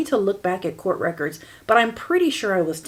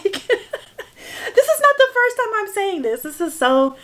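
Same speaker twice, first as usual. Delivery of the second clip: high voice breaking into laughter; teary laugh; 11 semitones higher, slower than usual. Real, not acted. Host admits she's emotional, laughing through it. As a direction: laugh through rising tears. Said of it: "I cannot believe I'm getting emotional".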